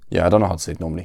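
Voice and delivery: usual speaking voice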